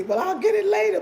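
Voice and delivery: high, loud mimicked excuse; mock excuse-maker. Voicing a thirsty person who won't drink now, high mock excuse.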